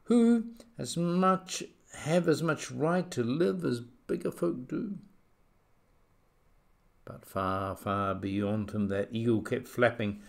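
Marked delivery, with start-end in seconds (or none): 0.0-5.0 begging. A narrator's reading. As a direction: beg earnestly, lingering on the words. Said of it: "And begged".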